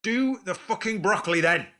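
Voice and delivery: loud, high angry snap. Real, not acted. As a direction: yell it in exasperation. Heated argument over side dish.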